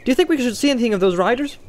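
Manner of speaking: bright, fast and high; cheerful. A narrator's reading. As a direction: toss it off cheerfully. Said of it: "asked Pippin cheerfully".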